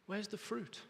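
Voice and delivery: soft, sorrowful repeat; quiet. Acted. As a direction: say it softly, grieving. God's quote repeated softly.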